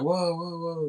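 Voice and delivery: high-pitched imitated protest; mock alarm. Tourists facing farmers' guns, mock alarm.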